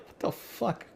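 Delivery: hushed, stunned whisper; whispered disbelief. Retold or imitated. Reacting to a careless offer, whispered disbelief. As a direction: breathe it out in shock.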